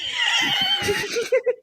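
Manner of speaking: very high burst of laughter; high, helpless giggling; real laughter. Real, not acted. Laughing at the microphone remark.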